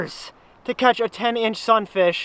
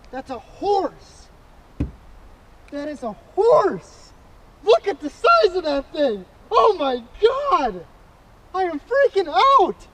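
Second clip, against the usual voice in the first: real fishing excitement rising; thrilled outburst; 7 semitones higher than usual. Real, not acted. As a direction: let the joy spill out. Thrilled by personal best fish.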